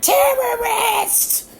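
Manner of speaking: very high, slow, drawn-out shout of alarm; old lady spotting the bong, shrieking in alarm; panicked old lady shriek. Retold or imitated. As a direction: shriek it like a terrified old woman on a plane. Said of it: "and she's like".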